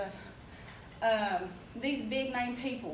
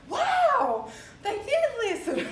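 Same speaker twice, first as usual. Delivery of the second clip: high, loud, laughing amazement; laughing delight; 11 semitones higher, 7 dB louder, much slower than usual. Retold or imitated. Hearing the bill passed with no opposition, laughing delight. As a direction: laugh it out in joyful disbelief.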